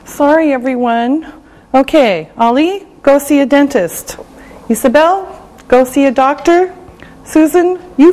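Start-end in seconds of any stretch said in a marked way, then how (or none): none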